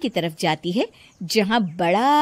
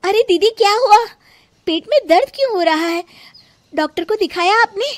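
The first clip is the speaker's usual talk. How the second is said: much higher, quick worried questions; alarmed concern; 12 semitones higher, 7 dB louder, faster than usual. Acted. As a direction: rush to ask what happened. Priya alarmed.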